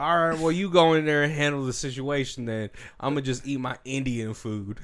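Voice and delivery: imitated Western lawman, starting loud and easing off; Jeff Bridges. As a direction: do a crusty Western cop's accent. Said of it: "That's a good Jeff Bridges".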